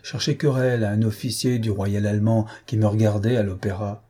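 ordinary delivery, nothing marked

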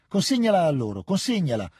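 commanding, almost yelling at the woman; near-shout. A narrator's reading. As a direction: push the child away with a half-shouted order. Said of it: "urlò quasi alla donna".

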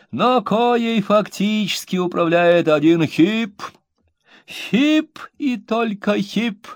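high, slow, grand denunciation; expressive pompous reading. A narrator's reading. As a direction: hammer home each grand phrase. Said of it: "выразительностью его чтения".

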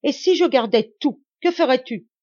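father challenges his daughter, shouting; defiant shout. A narrator's reading. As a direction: throw the challenge at her.

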